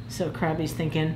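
plain reading voice

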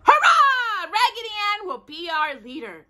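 high loud cheer, laughing; shouted hurrah. A narrator's reading. Dolls clapping and cheering their new leader, high and loud.

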